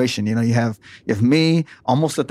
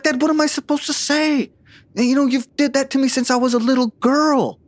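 much higher, pleading mock daughter's voice; imitating Victoria upset; 13 semitones higher than usual. Detective retelling Victoria confronting her father, high mock-tearful voice. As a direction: play the tearful daughter begging her dad, lightly. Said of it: "she starts to cry at him and scream".

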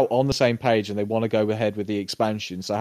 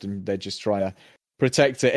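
ordinary talk, nothing marked

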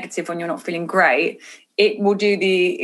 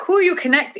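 high-pitched mock German accent; bad German accent; 11 semitones higher than usual. Hairdresser at dinner asking her about her focus, bad German accent. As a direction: imitate a German friend, pitched way up. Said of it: "that's the worst impression of your accent ever".

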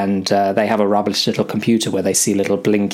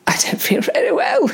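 very high, squeaky sick voice; tiny strained voice of a sick man; queasy squeak. Acted. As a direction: squeak it out like a feeble patient. Sick Sebastian voiced in story.

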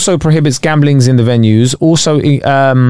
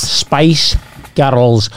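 Scottish accent. Shown as a demonstration. Co-host practising until it's good, Scottish accent. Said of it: "Spice Girls in a Scottish accent".

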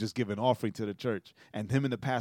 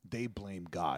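low, softer, heavy final line; grave; 6 semitones lower, 8 dB quieter, slower than usual. Real, not acted. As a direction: drop your voice for the verdict. Grave conclusion.

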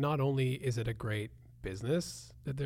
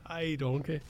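put-on Scottish accent; 2 semitones higher than usual. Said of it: "All I wanna say is donkey".

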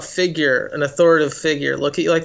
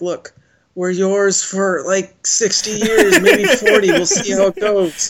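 mock bargaining; 4 semitones higher, 4 dB louder, slower than usual. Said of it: "I'm like".

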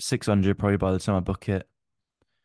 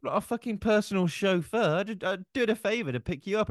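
an exasperated retort, much higher; retold rant; 11 semitones higher than usual. Friend retelling how he ranted at his ungrateful mate, exasperated mock rant. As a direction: play up the indignant driver, mock fury. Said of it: "I was like".

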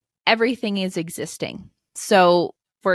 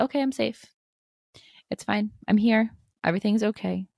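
higher, gentle reassurance to yourself; calm yourself down like a parent would; soothing self-talk; 4 semitones higher than usual. Shown as a demonstration. Home after the boss yelled, soothing herself, higher and gentle. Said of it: "just be like".

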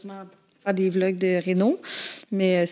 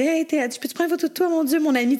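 excited fan; 6 semitones higher than usual. Retold or imitated.

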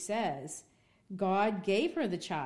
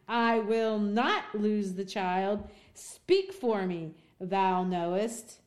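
louder, slow, pleading with the pastor; impassioned plea; 3 semitones higher, 4 dB louder than usual. Acted. Hester begging her pastor Dimmesdale to defend her, a louder slow plea. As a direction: beg him to speak for her.

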